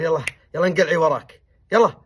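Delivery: loud, angry brush-off; harsh dismissal. Retold or imitated. Sheikh harshly driving away his wife, loud and angry. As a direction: shove her away with your voice.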